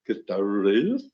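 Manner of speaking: put-on voice with a rising end; Gustavo Reyes. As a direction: say the name as an impersonation, lifting the end. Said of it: "instead of going".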